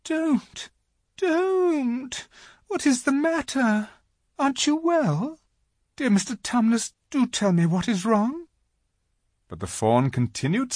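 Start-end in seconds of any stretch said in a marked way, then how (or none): 0.0-8.5 put-on high, pleading, upset girl; great distress. A narrator's reading. Lucy begging the sobbing faun to stop, distressed. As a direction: beg anxiously in a high voice. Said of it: "said Lucy in great distress".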